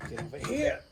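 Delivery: straining hard, pushed out loud and high; effort grunt. Real, not acted. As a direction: grunt it out while forcing the piece. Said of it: "Sorry, I'm grunting".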